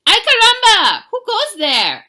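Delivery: high, very loud cartoon shout; hammed-up hero's yell; startled challenge. A narrator's reading. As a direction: cry out in mock alarm at strangers. Hero challenges strangers in story.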